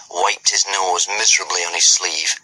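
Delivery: ordinary delivery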